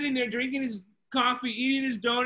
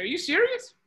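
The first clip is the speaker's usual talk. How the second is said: high-pitched, disbelieving question; incredulous surprise; 8 semitones higher than usual. Retold or imitated. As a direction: act out the owner's startled disbelief. Diner owner reacts to party offer.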